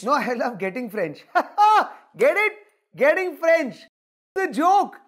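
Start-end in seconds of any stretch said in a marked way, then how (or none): 1.3-1.9 high, loud laugh at his own pun; loud forced laugh. Real, not acted. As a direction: cackle at your own joke.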